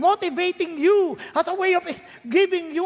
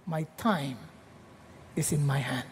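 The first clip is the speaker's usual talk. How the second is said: God's quiet answer; 13 semitones lower, 10 dB quieter than usual. Acted.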